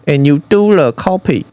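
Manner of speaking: normal talking voice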